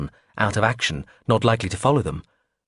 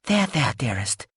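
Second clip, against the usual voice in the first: soothing; 4 semitones higher, slower than usual. Acted. Soothing friend.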